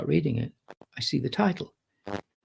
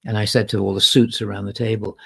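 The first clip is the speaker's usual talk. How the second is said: ordinary talk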